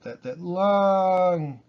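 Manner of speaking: Italian lilt with long, drawn-out stressed vowels; slow Italian-style stress on "long" and "accent". Shown as a demonstration. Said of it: "like an Italian accent".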